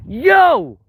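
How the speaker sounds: sudden high, loud yell. Real, not acted. Streamer greeting a viewer in chat, loud high yell. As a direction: belt it out to the chat. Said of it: "That was a bit loud".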